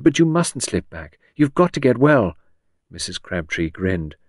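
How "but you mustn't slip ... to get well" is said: higher, insistent pleading; urgent cry. A narrator's reading. Visitor begs patient not to slip back, urgent cry. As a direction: urge her earnestly, voice lifted. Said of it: "Henrietta cried".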